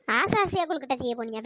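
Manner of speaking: low-pitched scornful repeat; mocking echo. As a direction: throw her words back with contempt. Mockingly echoes the weeper's words. (ஏளனமாகத் தாழ்ந்த குரலில் திருப்பிக் கேட்பது)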